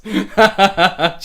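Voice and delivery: loud, high burst of laughter. Real, not acted. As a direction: crack up in a run of ha-has. Amused by a sudden sight.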